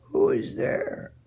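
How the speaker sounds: very high, slow, booming wolf's question; big wolf voice. Acted. Wolf in grandmother's bed booms at the knock, high and slow. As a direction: loom over the door with it.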